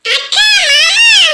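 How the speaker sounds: put-on high, drawn-out child's call; little child calling out. Little girl greets her aunt and uncle, calling out.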